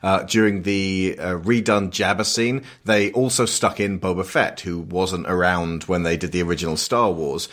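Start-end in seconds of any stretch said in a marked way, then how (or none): none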